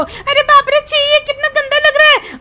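high, squeaky cry of revulsion; disgusted squeal. Acted. Companion sees blood leaking from the car, disgusted squeal. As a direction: recoil in a loud, high little voice.

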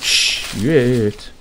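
jolted by a sudden fright; quick, startled exclamation; startled curse. Real, not acted. Sudden scare in game.

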